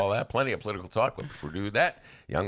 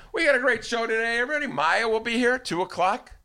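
high, louder upbeat host announce; show host hype; 13 semitones higher, 3 dB louder than usual. Real, not acted. Host opening his show, upbeat and high.

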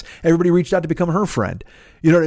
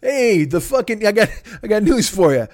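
ordinary talk, nothing marked

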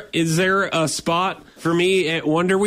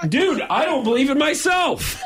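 laughing through the words, pitched up; 10 semitones higher than usual. Real, not acted. Prankster breaking into laughter on the phone, laughing high. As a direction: crack up while trying to keep the prank going. Prankster cracking up mid-call.

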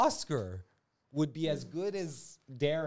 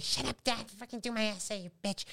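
put-on higher snotty teen sneer; bratty daughter; 8 semitones higher than usual. Comedian voicing the horrible daughter insulting her dad, bratty. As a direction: sneer it like a spoiled brat. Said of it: "that's how she talks".

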